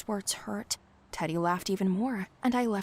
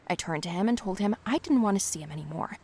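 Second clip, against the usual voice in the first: plain reading voice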